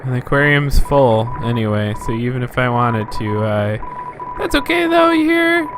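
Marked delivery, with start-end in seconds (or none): 4.4-5.7 high character voice. Acted. Voicing a game character.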